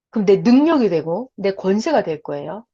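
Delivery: ordinary talk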